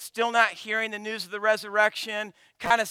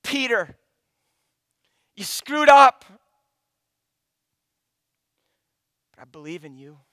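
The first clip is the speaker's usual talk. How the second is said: tender Jesus; 2 semitones lower, 6 dB louder than usual. Pretend. Jesus to Peter, firm then tender and hushed. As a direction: reassure him, fading to a whisper. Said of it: "Jesus singles him out".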